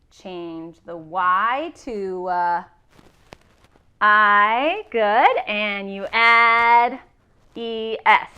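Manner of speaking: stretched out, then loud on the I and the E S; teacher drawing out each step for the class; slow, stressed spelling rule. Shown as a demonstration. Teacher spelling the rule out slowly to her class. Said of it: "Change the Y to an I and you add E S".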